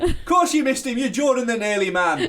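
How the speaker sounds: high, loud scoffing outburst; mock exasperation. Host ribs the pursuer who missed, mock-exasperated jeer. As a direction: leap up high in mock outrage, then come down.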